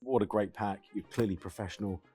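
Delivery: normal talking voice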